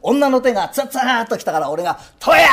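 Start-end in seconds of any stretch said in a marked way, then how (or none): 2.2-2.5 crying shout of the call; loud strained theatre call; stage cheer shout. Retold or imitated. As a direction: yell the cheer with a mouthful. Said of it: "おまんま粒がバラバラバラバラ".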